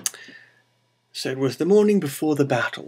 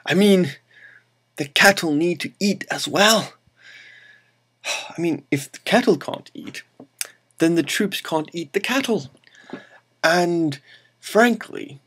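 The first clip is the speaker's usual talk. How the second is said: higher-pitched, incredulous protest; mock disbelief; 6 semitones higher than usual. Explaining why troops need the cattle fed, mock incredulous. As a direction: argue it as if it were obvious.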